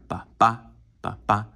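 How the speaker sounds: ba BA, each second beat stressed; weak-strong pulse, second ba hit harder; iambic stress. Shown as a demonstration. Teacher demonstrating iambs to a class, stress on every second ba. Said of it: "This is emphasis. This is accent".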